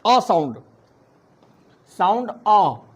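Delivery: अ said as a short neutral uh vowel; teaching the a sound. Shown as a demonstration. Teacher demonstrates the third phonic sound अ. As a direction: say अ briefly and plainly for the students. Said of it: "तीसरा sound आपका होगा".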